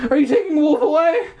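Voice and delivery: put-on high-pitched boyish panic; shrill, trembling. Boy asking dad about losing his dog, shrill. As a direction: squeak it out shrilly, hammed up. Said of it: "I repeated shrilly, my voice trembling".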